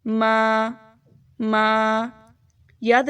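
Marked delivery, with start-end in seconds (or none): 0.0-1.7 ما stretched out long. Shown as a demonstration. Teacher lengthening ما for students. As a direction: hold the vowel in ما. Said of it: "تھوڑا سا لمبا کریں گے".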